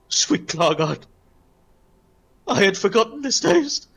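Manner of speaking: grand dragon warrior, rising high in wonder; awed Klargard. Acted. Klargard tastes pastry after long abstinence, awed. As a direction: savor it in a swelling, rising voice. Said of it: "As he goes".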